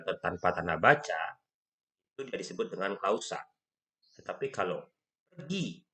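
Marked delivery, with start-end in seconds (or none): 5.3-5.8 "pergi" said higher, as an order; lightly voiced imperative "pergi"; commanding tone. Shown as a demonstration. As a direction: give "pergi" a command's falling tune. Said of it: "itu dengan intonasi memerintah".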